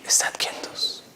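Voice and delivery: whispered, very soft; hushed command. Retold or imitated. Preacher voices Moses before the great sea, in a hushed whisper. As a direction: breathe it out as a calming whisper.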